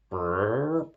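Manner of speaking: lips buzzing like a tuba; tuba noise. Acted. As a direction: blow a brassy tuba raspberry. Tuba played for fish.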